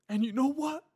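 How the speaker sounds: high, loud, mock-tearful voice; tearful coworker. Retelling the crying coworker praising the father who beat him. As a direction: overdo a grateful, weeping confession. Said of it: "but he said something like".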